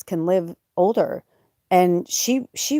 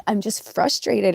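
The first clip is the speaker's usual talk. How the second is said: slow, higher-pitched mock whine; retold tearful frustration; 4 semitones higher than usual. Explaining herself to her cat, tearful frustration. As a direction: draw it out, half-crying. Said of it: "I started crying".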